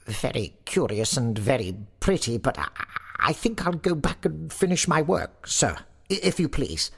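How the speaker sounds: high, hesitant, stammering voice; frightened pleading. A narrator's reading. Sexton begs to get back to work, frightened. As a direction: plead timidly in a high, scared voice. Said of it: "half dead with fright".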